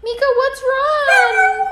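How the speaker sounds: high pet talk. Real, not acted. Calling the upset pet.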